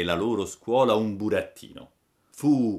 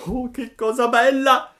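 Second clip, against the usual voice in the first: high, loud jubilant shout; joyful cry; 12 dB louder than usual. Acted. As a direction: jump for joy as you shout it. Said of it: "gridò Pinocchio saltando dall'allegrezza". (Pinocchio grida di gioia, acuto e forte)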